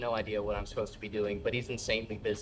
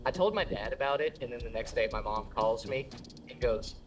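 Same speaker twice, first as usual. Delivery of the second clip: ordinary delivery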